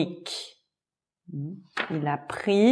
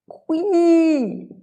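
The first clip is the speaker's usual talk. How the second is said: much higher squeaking like a mouse; 12 semitones higher, much slower than usual. A narrator's reading. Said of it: "Il a crié comme une souris". (couinement de souris très aigu)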